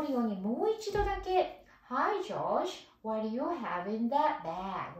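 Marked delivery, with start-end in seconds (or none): 1.9-4.9 slow "what do you have in that bag?" for rhythm; teacher modelling the sentence for first-years; rhythmic model read. Shown as a demonstration. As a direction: read it slowly so the rhythm sticks. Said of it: "こういう感じで読んでください".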